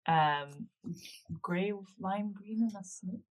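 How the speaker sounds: hushed, hesitant question to a friend; whispering. Acted. Player's character quietly asks Greta about a banner, whispering. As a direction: drop to a whisper, fishing for the answer. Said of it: "so she'll whisper to Greta".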